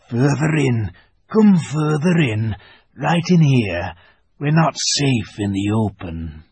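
lisping buck-toothed beaver whispering hoarsely; hoarse throaty whisper. Acted. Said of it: "in a hoarse, throaty whisper".

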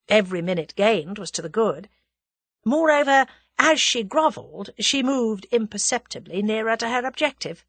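plain reading voice

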